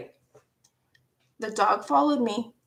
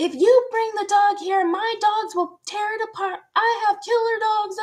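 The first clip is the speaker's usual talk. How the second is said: high-pitched mock scolding; raised, high voice of a nagging mom; retold scolding; 10 semitones higher than usual. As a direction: imitate a parent yelling a warning. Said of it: "I got yelled at".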